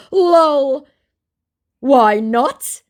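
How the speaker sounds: high, loud put-on voice; brazen scammer. Scammer refusing to drop it, mock brazen. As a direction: crow it in mockery of the scammer.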